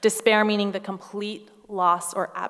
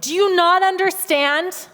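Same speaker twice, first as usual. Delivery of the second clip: slow, higher, louder pleading; mock frustrated outburst, higher and louder; exasperated; 7 semitones higher, 7 dB louder, slower than usual. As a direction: shake sense into him with each word. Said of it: "I would have gotten physical with Jesus".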